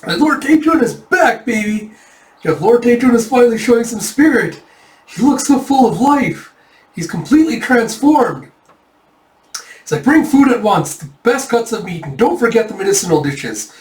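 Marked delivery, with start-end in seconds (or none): 0.0-8.5 high, excited crowd of admirers; thrilled, pitched-up hurrah; jubilant cheering. Acted. Soldiers cheer their revived lord. 10.0-13.7 high, eager commands; rally the servants with great enthusiasm; bustling, pitched-up urgency; excited orders. Acted. Soldiers eagerly ordering food.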